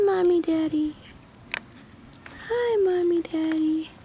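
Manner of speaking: high little-dog voice; squeaky puppy voice. Pretend. Voicing puppy greeting parents.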